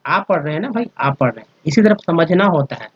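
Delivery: ordinary talk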